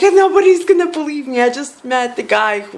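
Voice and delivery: high, loud, rushed excitement; excited announcement. Retold or imitated. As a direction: blurt it out fast and high, thrilled. Retells her thrilled post to followers.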